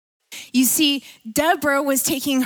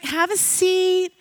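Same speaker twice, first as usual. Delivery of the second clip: slow, higher Southern-lady invitation; Southern hostess; 5 semitones higher, slower than usual. Retold or imitated. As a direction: drawl a hospitable offer to sit. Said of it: "she's like a Southern woman".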